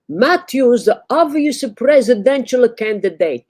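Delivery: put-on loud, high Italian barber accent. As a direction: belt it out like a brash Italian barber. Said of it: "perform a political monologue like a loud Italian".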